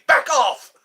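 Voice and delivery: short, loud, high-pitched shout; guard's warning yell. Acted. Imitating guards warning off onlookers, commanding shout. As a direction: yell it like a royal sentry. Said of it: "I like watching them say".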